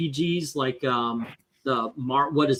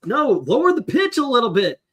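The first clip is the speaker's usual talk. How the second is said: higher, slightly raised joking voice; mock director; 8 semitones higher than usual. Imagined dub director correcting the voice actor, mock bossy. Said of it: "He's like".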